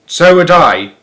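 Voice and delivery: put-on old woman loudly agreeing; crying out. A narrator's reading. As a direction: chime in loudly. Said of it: "cried Grandma Georgina".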